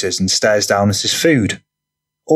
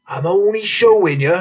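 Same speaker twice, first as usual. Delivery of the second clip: high, defensive protest; 10 semitones higher than usual. Acted. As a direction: protest innocence, voice pitched right up. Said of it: "Franny defensively claims".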